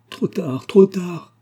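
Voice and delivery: cri déchirant. A narrator's reading. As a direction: wail it, the second time far stronger. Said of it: "À ce cri". (cri de désespoir qui monte)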